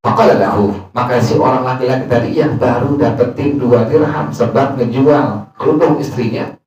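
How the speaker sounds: normal talking voice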